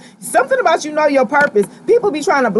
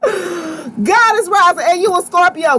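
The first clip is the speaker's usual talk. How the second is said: laughing through the words, slow; real laugh spilling into the line; laughing, drawn out; 6 semitones higher, slower than usual. Real, not acted. Laughing at the card pulled.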